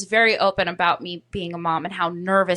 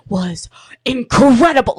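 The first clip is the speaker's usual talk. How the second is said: bragging; 8 dB louder than usual. Retold or imitated. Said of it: "like, bragging".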